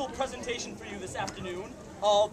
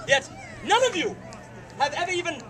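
angry; 4 semitones higher, 3 dB louder than usual. Acted. Director outraged.

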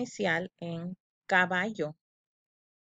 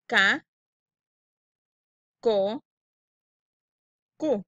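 louder "Ca, co, cu", each syllable alone; choral syllables; 5 dB louder, slower than usual. Shown as a demonstration. Teacher reading syllables aloud with the class. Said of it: "Quiero que lean conmigo".

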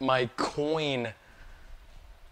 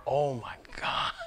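stunned whisper; 6 dB quieter than usual. Real, not acted.

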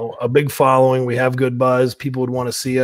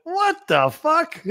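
slow, much higher stunned "what the fuck"; stunned disbelief; much slower than usual. Retold or imitated. Hearing from the boss he is fired, slow stunned disbelief. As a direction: let it drag out in shock.

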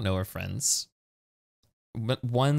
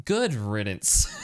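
laughing; 13 semitones higher, slower than usual. Real, not acted. Reads joke option laughing.

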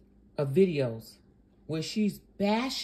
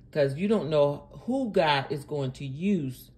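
ordinary talk, nothing marked

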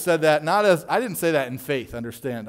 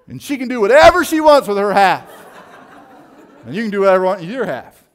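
laughing while quoting; 5 semitones higher, 12 dB louder than usual. Retold or imitated. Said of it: "He goes".

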